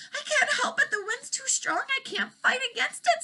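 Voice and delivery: high, desperate dragon cry; screaming. Acted. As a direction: scream it over the storm. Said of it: "screamed the dragon".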